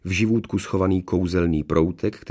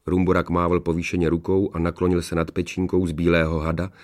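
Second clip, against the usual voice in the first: plain reading voice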